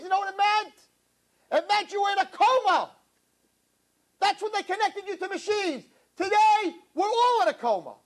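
high, impassioned preacher's voice; preaching at full force. Acted. Rabbi urging congregation what to ask God, impassioned preaching. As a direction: drive each line home to the crowd.

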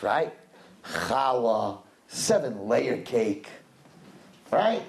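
ordinary talk, nothing marked

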